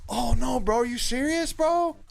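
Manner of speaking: high, wounded bro disbelief; Booger. Retold or imitated. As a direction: imitate a slacker stunned at being fired. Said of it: "Booger looks at him and goes".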